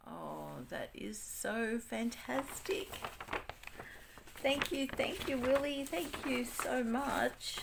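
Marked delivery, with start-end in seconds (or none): 4.4-7.6 thrilled high-pitched thank-yous; gushing thanks. Real, not acted. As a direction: pour out the thanks. Thanking friend for gift.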